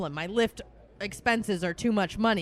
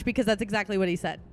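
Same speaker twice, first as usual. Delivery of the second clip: ordinary talk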